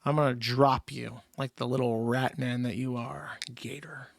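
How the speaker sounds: menacing whisper. Retold or imitated. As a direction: hiss the threat low. Voicing Legal Mindset threatening Gator.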